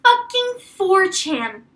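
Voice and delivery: loud, drawn-out exasperation; slow, loud disgusted exclamation; exasperated outburst. Retold or imitated. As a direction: spit it out slow and loud in disbelief.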